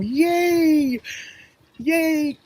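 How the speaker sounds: high, happy cheer; excited cheer. Real, not acted. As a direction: cheer them on with a squeal. Cheering the viewers on.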